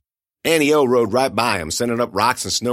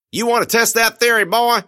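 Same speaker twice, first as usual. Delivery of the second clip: put-on high, loud menacing old man; Otis threatening; 12 semitones higher, 5 dB louder than usual. Old man pumping shotgun at captive, loud high threat. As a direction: threaten him, pitched up and loud.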